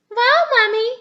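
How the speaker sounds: high, loud amazed gasp; breathless with wonder. A narrator's reading. Peppa gasps as Mummy catches the ball. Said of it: "gasped Peppa".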